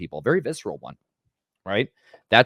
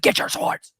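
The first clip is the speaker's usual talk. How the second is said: hushed, grim whispered command; whispered order; 3 dB louder, slower than usual. Acted. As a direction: breathe the order low and deadly. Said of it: "He's like".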